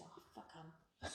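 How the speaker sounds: mock mutter under the breath, very slow; muttered aside. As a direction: imitate a defiant aside, barely voiced. Mum's defiant aside after nurse leaves.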